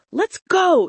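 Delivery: high, loud burst of excitement; a thrilled rush to leave; excited. Acted. Sophia urging the girls out at night, excited. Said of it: "Sophia whispered, excitement in her voice".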